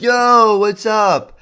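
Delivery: mock hyped party joiner; loud intruder greeting. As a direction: burst in like an uninvited gamer. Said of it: "they're like".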